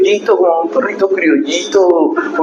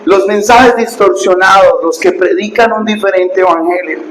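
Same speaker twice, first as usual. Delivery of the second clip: fiery preaching; 4 semitones higher than usual. Acted. Pastor warning his congregation about false teachers, in fiery preaching. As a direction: preach it hard, warn the flock.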